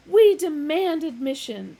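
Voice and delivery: barging philosopher shouting. A narrator's reading. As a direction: bellow the demand. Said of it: "shouted the younger".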